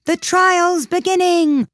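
high, loud announcing call; loud high shout; distant cry. Acted. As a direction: cry it out across the court. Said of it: "a cry of".

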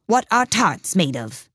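plain reading voice

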